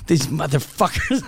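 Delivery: laughing, put-on grumble; grumbling in the car. Grumbling alone in his car about the mechanics, half laughing. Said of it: "in my car going".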